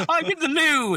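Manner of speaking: high, loud, laughing English voice; posh English accent. Retold or imitated. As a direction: chirp it like a prim Englishman. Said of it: "He's like".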